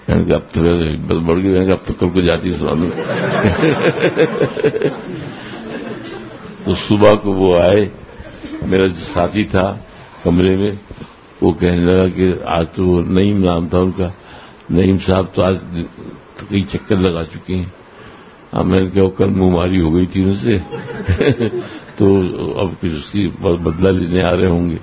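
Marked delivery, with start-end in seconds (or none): 2.9-6.6 high breathy giggling laugh. Real, not acted. Telling listeners of his new courage, high giggling laugh. 20.6-21.9 brief high chuckle; laughing. Real, not acted. Telling a colleague's part in the story, brief chuckle. Amused recalling the quarrel.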